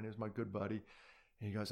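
ordinary talk, nothing marked

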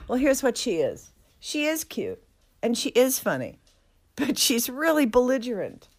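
normal talking voice

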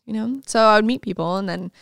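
ordinary delivery

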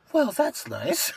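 changed demeanor. Retold or imitated. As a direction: imitate a store guy suddenly changing his tune. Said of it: "his whole demeanor changed".

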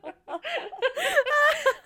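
high, loud peal of laughter; helpless laugh. Real, not acted. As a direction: laugh uncontrollably. Cracks up at being imitated accurately.

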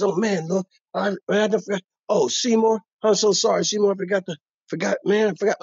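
high, pleading fake apology; whiny excuse. Customer whining to Seymour about a forgotten wallet.

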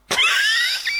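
high-pitched burst of laughter. Real, not acted. Friend laughs at his own joke, high and squealing. Laughs at Mister Freeze comparison.

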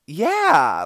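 a loud, high, eager "Yeah"; excited yeah. Retold or imitated. Answering the strangers eagerly, loud and bright. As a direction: burst out with eager agreement. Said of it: "just excitedly".

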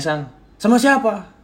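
high, loud, accusatory question; accusing. Shown as a demonstration. Partner demanding to know who the speaker was with, accusing. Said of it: "nanya atau menuduhkan".

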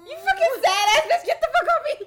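very high, loud joking outburst with laughter; mock outraged yell. Friend mocking the criers, high laughing mock yell. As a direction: play at shoving someone off, yelling and laughing.

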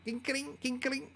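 light, high clicky mouth sound; keyboard click imitation. Showing viewers the keyboard sound, playful high click imitation. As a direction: chirp it like keys clacking.

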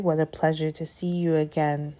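usual speaking voice

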